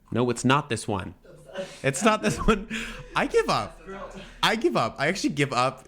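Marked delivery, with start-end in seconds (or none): 1.8-2.7 higher, helpless laugh in the words; giggly defeat; laughing exasperation. Real, not acted. Failing to find the list. 3.2-5.9 high-pitched giving up; playful exasperation; exasperated surrender. Real, not acted. Failing to find the list.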